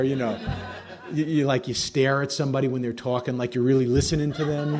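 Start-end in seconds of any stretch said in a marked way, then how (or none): none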